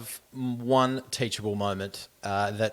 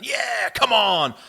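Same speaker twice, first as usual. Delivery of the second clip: slow, much higher, loud cheer; sideline hype; 11 semitones higher, 8 dB louder, much slower than usual. Retold or imitated. Coach imitated high-fiving on the sideline, loud high hype. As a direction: pump up the team like a fired-up coach. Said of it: "he was up and about going".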